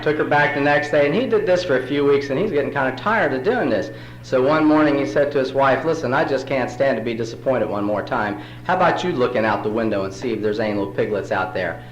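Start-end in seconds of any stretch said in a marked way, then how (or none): none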